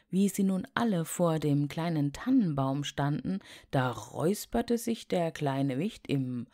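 plain reading voice

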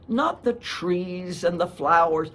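usual speaking voice

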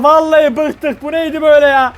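ordinary talk

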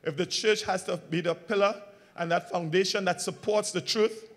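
ordinary delivery

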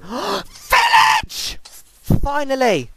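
gasp, then a high, loud cry of relief; thrilled shout. Real, not acted. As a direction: shout it like you've found safety at last. Spots village after danger.

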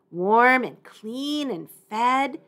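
ordinary delivery

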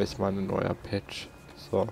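ordinary delivery

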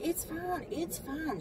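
high, soft sugary "It's fine"; sweet teller voice. Retold or imitated. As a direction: imitate the nice girl, lifted and hushed.

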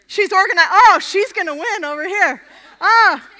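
loud, high excited call; a burst of real excitement; excited shout. Real, not acted. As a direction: cheer at the crowd. Excited outburst during group activity.

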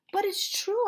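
high, loud protest; exclaiming. A narrator's reading. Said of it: "I exclaimed".

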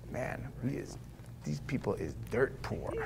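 hushed, almost whispered thought; inner thought. Retold or imitated. Preacher recalling his thought in the favela, hushed. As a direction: breathe it out to yourself in shock. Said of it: "I'm like".

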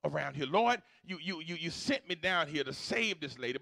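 ordinary talk, nothing marked

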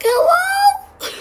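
very high, slow cracked cry; forcing the voice. Shown as a demonstration. Gamer with a lost voice tries speaking normally, cracking high. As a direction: strain a broken voice way up on hello. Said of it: "So I speak normally".

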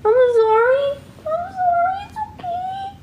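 extremely high, squeaky cooing apology; cooing pet talk. Real, not acted. Owner comforting her sobbing dog, very high cooing. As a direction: coo it right at the top of the voice.

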